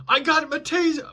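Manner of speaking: high, loud mock cop shout; imitating a cop yelling. Cop warning the suspect under the car, high loud shout. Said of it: "I hear a cop yell".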